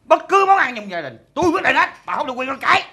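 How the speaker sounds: loud, forceful ranting; angry, domineering. Acted. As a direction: lay down the law loudly. Husband lays down the law.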